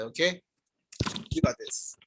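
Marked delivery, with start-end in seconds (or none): 1.3-2.0 whispering. Real, not acted. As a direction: whisper it like a secret cheer. Teacher encourages tired students.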